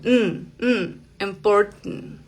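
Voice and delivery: nasal hum, then "important" ending through the nose; very nasal glottal T. Shown as a demonstration. Teacher showing how nasal it is, glottal T. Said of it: "es muy nasal".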